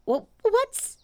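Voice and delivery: high, stammering little pig; a timid tiny animal; small piglet voice. A narrator's reading. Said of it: "Piglet whispered to Pooh".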